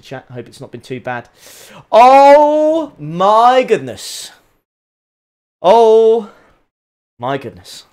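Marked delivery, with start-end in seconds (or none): 1.9-4.3 long, slow, loud "oh my goodness", pitched up; drawn-out amazement. Real, not acted. Stunned by the livery. 5.6-7.9 amazed oh. Real, not acted. Stunned by the livery.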